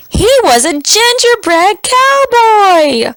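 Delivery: high, delighted announcement; excited reveal. A narrator's reading. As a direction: build it up with delight. Narrator emphatic reveal.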